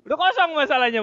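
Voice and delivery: can't hold back a laugh. Real, not acted. Laughs at own story. (sambil tertawa)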